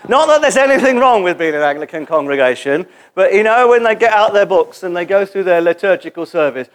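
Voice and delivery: usual speaking voice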